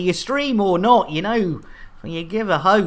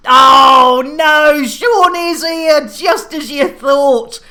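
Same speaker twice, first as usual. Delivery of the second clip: loud, slow, high dramatic proclamation; dramatic announcer shout; 8 semitones higher, 9 dB louder, slower than usual. Acted. Streamer proclaiming a viewer's arrival with a slow dramatic shout. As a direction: boom it out like a trailer voice.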